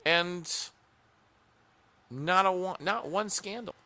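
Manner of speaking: high pitched insistence on not one; stressing no scandal; emphatic. Real, not acted. Insisting Johnson had no scandal.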